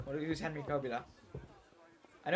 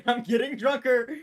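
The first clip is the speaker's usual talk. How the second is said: laughing, higher and louder exclamation; 8 semitones higher, 7 dB louder than usual. Real, not acted. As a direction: burst out laughing, slow and loud. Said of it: "Oh, I'm getting drunker".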